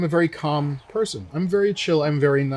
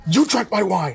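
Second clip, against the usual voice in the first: higher-pitched mock outburst; irate customer; 5 semitones higher than usual. Irate diner accusing the sommelier, imitated angry outburst.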